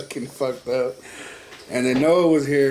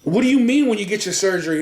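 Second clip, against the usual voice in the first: confused; 7 semitones higher than usual. Retold or imitated. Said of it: "retold confused question".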